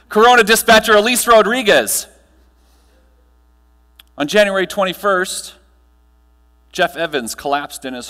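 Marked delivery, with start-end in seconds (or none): 0.1-2.1 higher and louder, announcing a winner; big reveal. Real, not acted. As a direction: land the name like a prize reveal. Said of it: "wait for it".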